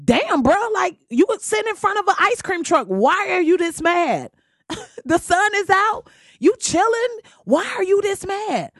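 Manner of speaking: high, incredulous ribbing of a scowling dude; exasperated friend. Retold or imitated. Teasing a scowling guy in the sun, high and incredulous. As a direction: needle him high and disbelieving about his mad face. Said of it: "You like".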